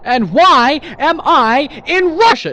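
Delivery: loud, high, drawn-out bewildered cry; alarmed yell. Acted. Waking up confused about where he is, alarmed yell. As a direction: blurt it loudly in mounting alarm.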